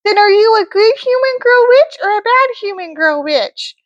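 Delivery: high, sweet fairy voice; sing-song good witch; Glenda the good witch. Acted. Glenda repeating question.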